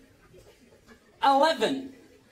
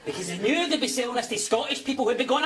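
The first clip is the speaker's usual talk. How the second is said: usual speaking voice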